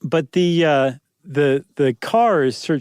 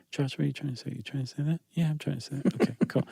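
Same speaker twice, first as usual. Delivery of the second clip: much softer, hushed voices arguing with himself; voices on the shoulders; 11 dB quieter, faster than usual. Pretend. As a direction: play the little devil and angel talking at his ear. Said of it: "the devil and the angel".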